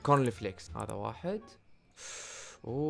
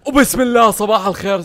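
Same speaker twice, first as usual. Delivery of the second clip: excited shout; 7 semitones higher, 12 dB louder than usual. Real, not acted. Excited start of episode.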